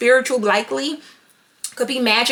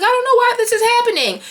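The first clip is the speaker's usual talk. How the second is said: high-pitched imitation of a crying girl; mock crying; 9 semitones higher, 3 dB louder than usual. Bullied-back mean girl from the film cries in bewilderment, mock crying. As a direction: wail it plaintively, without real tears. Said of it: "she's crying. She's like".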